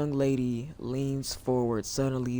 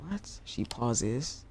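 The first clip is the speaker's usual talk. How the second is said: normal talking voice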